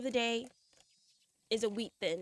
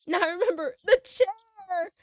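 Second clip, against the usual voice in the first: loud, slowed excited cry; excited shout; 8 semitones higher, 8 dB louder, slower than usual. Real, not acted. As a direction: burst out remembering it. Excited outburst while reading.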